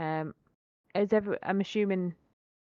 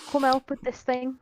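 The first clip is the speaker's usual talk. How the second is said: calling for help; 7 semitones higher, 3 dB louder than usual. Acted. Elf calls for help.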